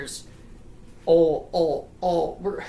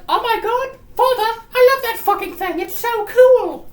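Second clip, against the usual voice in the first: very high, loud put-on girl voice; excited little girl; 7 dB louder than usual. Daughter admiring the robot to her father, squeaky and thrilled. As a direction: gush in a squeaky falsetto, stretching the end. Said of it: "and she's like".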